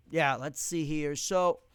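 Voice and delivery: usual speaking voice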